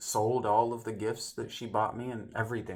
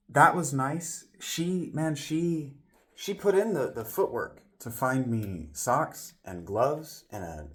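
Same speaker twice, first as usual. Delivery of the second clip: usual speaking voice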